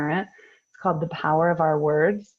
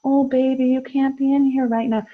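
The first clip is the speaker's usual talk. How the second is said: high, louder, syrupy sweet coaxing; sugary and flowery; 8 semitones higher, 4 dB louder than usual. Shown as a demonstration. Mocking an overly sweet parent, sugary cooing voice. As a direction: coo it like sugary baby talk. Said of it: "We don't have to be like".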